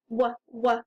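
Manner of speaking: "w, w," as two short "wuh" sounds; letter sound. Shown as a demonstration. Teacher shows the W says the right sound, letter sound isolated. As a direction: give the sound of "w, w," twice, not the letter name. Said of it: "The W says the right sound".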